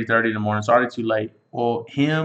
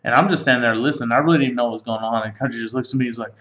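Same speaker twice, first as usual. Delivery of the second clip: ordinary delivery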